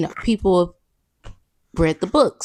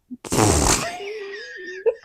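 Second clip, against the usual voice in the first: much higher squealing laughter; high shrieking laugh; 13 semitones higher than usual. Real, not acted. Failing her retake for viewers: shrieking laugh. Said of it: "I can't do it".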